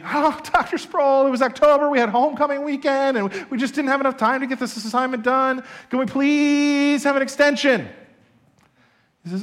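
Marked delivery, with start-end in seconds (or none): 0.0-7.9 high, whiny, laughing plea; pleading students. Retold or imitated. Students wheedling the professor for more time, high and whiny. Students begging again with excuses.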